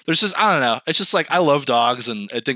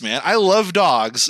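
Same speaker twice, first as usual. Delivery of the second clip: normal talking voice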